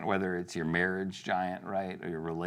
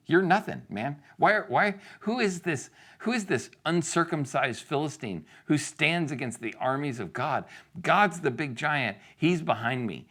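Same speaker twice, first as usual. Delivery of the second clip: high, raised-voice taunt; scornful defiance; 8 semitones higher, 3 dB louder than usual. Acted. David facing down Goliath, scornful and defiant. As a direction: dismiss a giant opponent with bold scorn.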